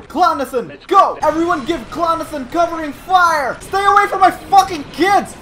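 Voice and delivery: enraged bellow at the droids; furious protective yell. Pretend. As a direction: defend your kids like a mama bear. Protective mother defending clones.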